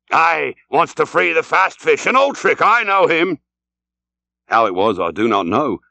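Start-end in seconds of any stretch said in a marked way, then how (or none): 0.1-3.4 high, knowing captain's interjection; raised seafarer's voice, pitched well up; Ahab breaking in. A narrator's reading. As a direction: cut in excitedly, sure of the foe.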